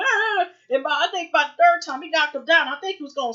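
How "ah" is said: a short high yelled "Ah!" in pain; imitated scream. Retelling the fight scene, a loud imitated pained scream. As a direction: cry out like you've just been hit. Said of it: "he's screaming".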